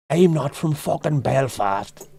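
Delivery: slow, drawn-out mock Belfast accent. Mocking a denial of being from Belfast, hammed-up Belfast accent. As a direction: exaggerate the Belfast accent indignantly. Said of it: "said in a Belfast accent".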